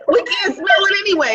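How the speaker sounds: laughing. Real, not acted. Joking about morning breath.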